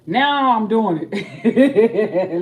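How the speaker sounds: slow, laughing words, loud; laughing at her own slip. Real, not acted. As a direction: giggle it out slowly.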